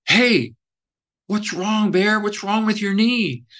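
high, lightly voiced kid asking what's wrong; little boys asking. Young campers asking their counselor about his knee, high kid voice. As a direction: raise the pitch like a worried young boy.

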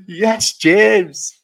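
high, drawn-out sing-song mimic; lightly hammed-up girl's greeting; mock girl voice. Retelling her greeting to the stream, mock girl voice.

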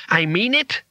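high, restrained scream; screamed threat. Acted. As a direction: insist in a high-pitched cry. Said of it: "screamed Bethan".

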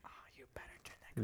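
fast breathy whisper. Acted. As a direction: whisper it quickly. Joking aside about compression.

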